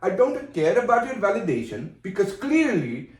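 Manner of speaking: indignant put-on Indian accent. Upset at co-host's opinion, in put-on Indian accent. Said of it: "this Bruce Dern accent you're doing right now".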